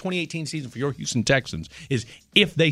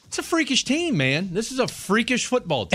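excited; 9 semitones higher than usual. Real, not acted. Hyped host praising the Texans.

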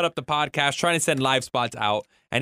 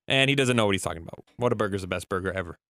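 ordinary talk

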